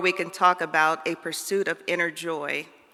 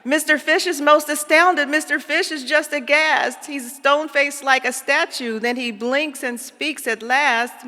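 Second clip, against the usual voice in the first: higher, louder amazed telling; astounded narration; 8 semitones higher, 6 dB louder than usual. A narrator's reading. Narrator reading the surprise kiss moment to kids, amazed. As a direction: tell it with wide-eyed astonishment.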